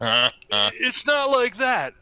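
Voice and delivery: high, loud, slow tearful scream; screaming near tears. Acted. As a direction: scream it, close to crying. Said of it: "I scream on the verge of tears".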